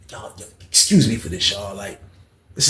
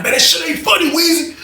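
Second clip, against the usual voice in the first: angry. Retold or imitated. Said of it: "He was like".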